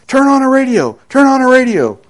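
high, urgent call to the room. Acted. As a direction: burst in and call out the alarm. Runner bursts in shouting.

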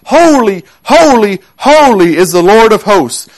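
high, loud proclamation of holiness; crying out. Acted. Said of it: "one cried to another and said".

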